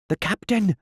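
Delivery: high, startled pirate cry; surprised. Acted. Pirates startled to hear their captain's voice. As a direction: gasp it out, shocked. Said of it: "staring at each other in surprise".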